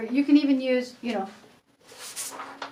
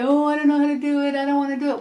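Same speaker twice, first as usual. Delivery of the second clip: light imitation of a reluctant kid; a hint of a child whining; mildly whiny child saying no; 3 semitones higher, 4 dB louder, faster than usual. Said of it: "they might say".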